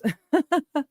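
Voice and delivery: short high laugh. Real, not acted. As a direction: laugh lightly. Laughs at own remark.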